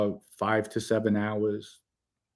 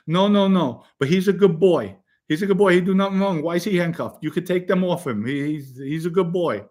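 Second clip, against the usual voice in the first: high, insistent broken-English Italian nonna; worked-up Italian grandmother defending her boy; upset Italian grandmother; 6 semitones higher than usual. Retold or imitated. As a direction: imitate an Italian grandmother pleading, voice pitched well up. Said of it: "And she's getting upset".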